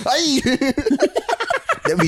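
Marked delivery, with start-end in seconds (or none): 0.1-0.4 high, sharp mock German yell; fake German scream. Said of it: "Or just screaming in German". 0.5-1.8 squealing high laugh. Real, not acted. Laughs after mock scream.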